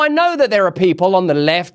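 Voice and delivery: ordinary delivery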